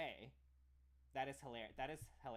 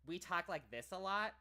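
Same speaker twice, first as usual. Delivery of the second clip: American "we talk like this a lot", pushed up higher and louder; tense American mouth; 6 semitones higher, 7 dB louder than usual. Shown as a demonstration. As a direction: show the tight American way of speaking. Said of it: "We, like".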